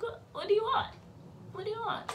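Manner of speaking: high exasperated what do you want; mock annoyed. Mocking the aunties who showed up, high and fed up. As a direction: squeal it out, fed up.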